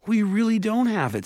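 high, loud insistence; protesting. Acted. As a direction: beg them to believe you. Mallory protesting to elves.

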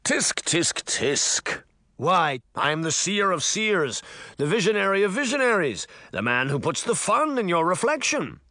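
high, grand showman mirror; dramatic flourish. A narrator's reading. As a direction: announce yourself like a showman. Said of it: "with a dramatic flourish".

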